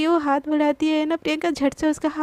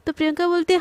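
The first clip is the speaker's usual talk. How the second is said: plain reading voice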